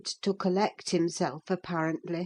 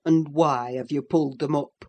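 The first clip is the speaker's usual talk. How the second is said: low, lightly voiced stern master; a gruff old master questioning; 5 semitones lower than usual. A narrator's reading. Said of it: "said the master".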